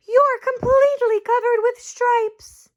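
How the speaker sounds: high, urgent mother voice; alarmed mother. Acted. Mother seeing the stripes, high alarmed voice. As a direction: sound the alarm like a worried mom. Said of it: "she cried".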